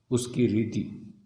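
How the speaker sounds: ordinary talk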